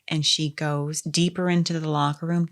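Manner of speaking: usual speaking voice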